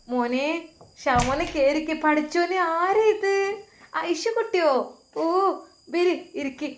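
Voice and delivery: high, eager welcome for the nephew; cheerful aunt. Acted.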